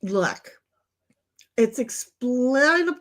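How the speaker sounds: normal talking voice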